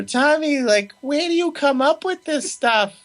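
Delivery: high, put-on voice of a fussing Italian mother; mocking mom voice. Son imitating his mom reacting to his horror film, high mock voice. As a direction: squeak it out like his worried mom. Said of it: "she got this funny voice that I do".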